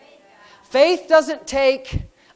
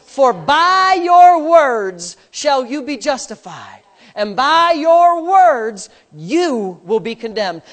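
slow, louder solemn quote; emphatic scripture; 5 dB louder, slower than usual. Real, not acted. Pastor proclaims scripture to the church, slow and weighty. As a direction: lay down scripture word by word.

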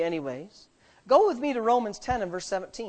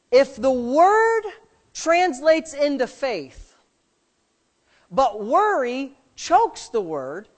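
slow emphatic preaching; 4 semitones higher, 5 dB louder, slower than usual. Acted.

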